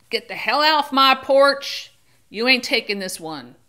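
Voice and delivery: put-on loud, high Southern accent that settles down; Southern grandmother hollering from her porch, then easing off; Southern accent, shouting at first. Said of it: "I did, like, a Southern accent".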